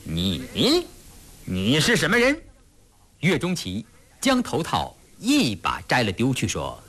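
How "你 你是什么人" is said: halting, baffled challenge to a stranger; bewildered suspicion. A narrator's reading. Officer confronts a stranger suddenly giving orders, bewildered. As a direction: falter on the first word, then demand warily. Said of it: "一脸狐疑惶惑".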